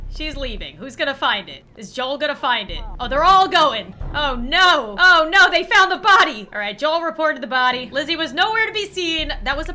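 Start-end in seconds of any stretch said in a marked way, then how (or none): 3.0-6.5 loud, building dismay; excited alarm. Real, not acted. Player watching the others find the body, loudly alarmed. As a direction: call out in mounting alarm.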